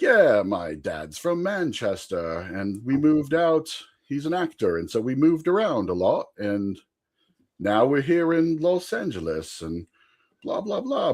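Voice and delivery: slow, put-on British accent. Storyteller invents a Manchester history for the coworker, put-on British accent. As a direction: spin a backstory in a mock British accent. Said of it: "have a British accent all weekend".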